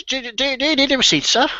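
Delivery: put-on high, hesitant old man's voice; eager to please the customer; fussy old shopkeeper. Shopkeeper asks about receipt.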